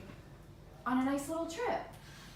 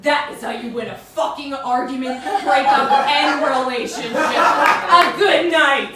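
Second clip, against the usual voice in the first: louder and slower toward the end; building punchline; 13 dB louder, slower than usual. Acted. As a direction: hammer the closer home. Punchline delivered to crowd.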